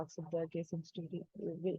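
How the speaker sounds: barely audible. Real, not acted. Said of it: "I couldn't hear".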